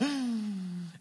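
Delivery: sharp audible intake of breath; acted gasp of fright; startled gasp. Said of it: "now they're like".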